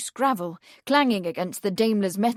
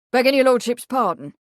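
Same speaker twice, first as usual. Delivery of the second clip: gruff servant, louder; 3 semitones higher, 6 dB louder, faster than usual. A narrator's reading. Stable man interrupting his lordship about the doctor, gruff. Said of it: "one of the men said gruffly".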